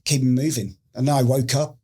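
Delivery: ordinary talk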